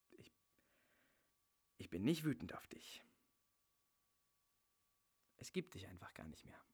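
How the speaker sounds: soft chilling whisper. Acted. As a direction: whisper it like a final verdict. Cold final words to ex.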